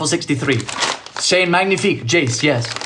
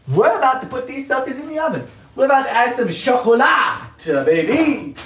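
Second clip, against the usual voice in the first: playful sing-song; 8 semitones higher than usual. Pretend.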